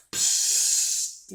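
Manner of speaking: short unvoiced psst like lights flicking on; mock electric fizz; imitated switch-on hiss. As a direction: hiss out the sound of power.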